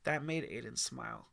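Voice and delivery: usual speaking voice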